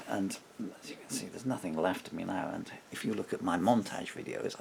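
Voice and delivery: ordinary talk, nothing marked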